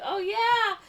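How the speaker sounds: very high, sheepish mock reply; fake casual admission. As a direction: squeak it as if just remembering. Acted awkward reply about invented daughter.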